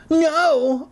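very high, sudden cry. A narrator's reading. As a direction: cry out in high-pitched protest. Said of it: "I cried".

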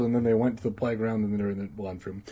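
rushed. Acted. Comic rushed recap.

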